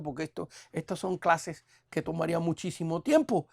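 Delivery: ordinary talk, nothing marked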